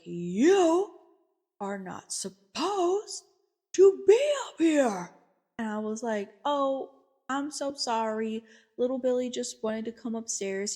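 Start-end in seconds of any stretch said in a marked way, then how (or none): 0.0-5.1 loud, high, drawn-out imitated outburst; angry yelling. Elder scolds the therapist for coming upstairs, angry yelling. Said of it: "please stop yelling". 6.4-10.7 higher-voiced, apologetic retelling; meek apology. Therapist explains to angry elder why they came upstairs, meek apology.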